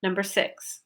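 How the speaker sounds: ordinary talk, nothing marked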